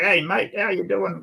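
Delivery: exaggerated broad Australian twang; put-on ocker Australian accent. Shown as a demonstration. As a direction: lay on a thick ocker accent. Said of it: "I can put on that accent".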